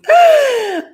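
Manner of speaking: loud, high shocked gasp; gasping scream. Acted. Lady shrieking at the window, loud and high. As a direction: gasp in fright. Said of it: "gave a scream".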